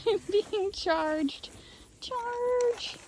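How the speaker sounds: high, slow amused alarm; laughing alarm. Real, not acted. Mobbed by the sheep, high amused squeal. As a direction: squeal at being charged.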